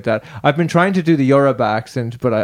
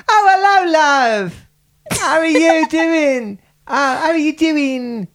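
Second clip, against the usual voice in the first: Essex accent; slower than usual. Shown as a demonstration. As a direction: put on a squeaky, slow Essex voice. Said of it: "I'll try the Essex".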